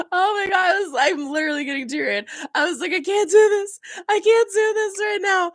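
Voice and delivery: high-pitched, overwhelmed near-wail; teary-eyed. Real, not acted. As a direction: pitch shoots up as if about to cry.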